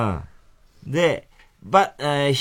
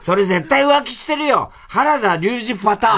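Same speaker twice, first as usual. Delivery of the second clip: high, insistent comeback; model retort; 5 semitones higher than usual. Shown as a demonstration. Host shows the retort he wanted, high comeback. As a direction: pitch it up as a punchline.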